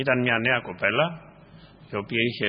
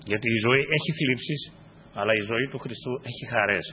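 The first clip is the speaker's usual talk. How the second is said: usual speaking voice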